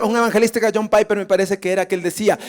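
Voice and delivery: usual speaking voice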